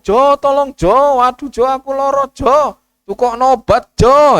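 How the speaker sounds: high, loud anguished calls to Jo; calling for help. Retold or imitated. Sick man calls his son for medicine, loud and high. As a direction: cry out to Jo in pain.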